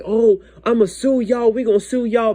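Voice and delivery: high-pitched imitation of an outraged mom; retold indignant threat. Mom threatening the restaurant over the burger, mock indignant. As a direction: act out mom's indignation, pitched well up.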